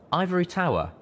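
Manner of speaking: a lightly voiced device announcing the name; crisp machine announcement; proclaimed. A narrator's reading. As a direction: read it out like a device. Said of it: "my E F S proclaimed".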